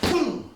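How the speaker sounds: high boom, falling sharply; explosive strike noise; imitated impact. Shown as a demonstration. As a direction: voice the hit as it lands. Imitates the demonstrated hit.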